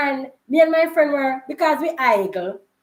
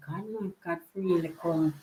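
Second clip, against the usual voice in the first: mock scared hush; 6 semitones lower, 8 dB quieter than usual. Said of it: "the two of we are meant to say".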